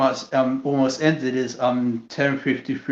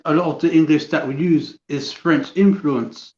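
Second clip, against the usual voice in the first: normal talking voice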